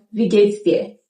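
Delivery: wie geht's dir, es clipped to s; casual wie geht's dir; reduced form. Shown as a demonstration. Teacher shows the spoken greeting.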